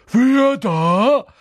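high, loud poltergeist's challenge; abrupt high-pitched shout; sudden challenge. A narrator's reading. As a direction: burst out like a suspicious sentry. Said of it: "fragte er plötzlich".

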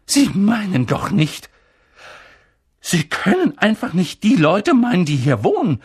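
high, loud protest; cried out. Acted. Professor protesting to Dumbledore about the family, crying out. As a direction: cry out in alarm. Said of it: "rief Professor McGonagall".

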